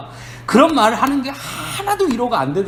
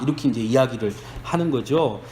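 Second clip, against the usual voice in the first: ordinary talk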